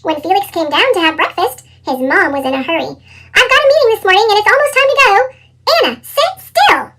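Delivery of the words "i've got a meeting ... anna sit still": fast, higher rushed mother; hurried mom. A narrator's reading. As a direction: rattle it off, late for work. Hurried mom before meeting.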